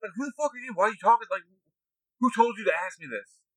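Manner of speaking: confrontational mock rant. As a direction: rage at strangers who dare to ask questions. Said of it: "I would come into spaces and be like".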